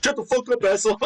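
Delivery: high, hammed-up angry snap; mock angry retort. Slim firing back at the friends, in mock anger. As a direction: play the insulted friend, half-laughing. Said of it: "Slim would be like".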